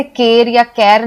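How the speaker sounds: केर as "kair", then opened to "kaer"; wrong vowels for car. Shown as a demonstration. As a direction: misread car as केर, two ways. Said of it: "नहीं बोलते".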